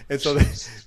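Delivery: ordinary delivery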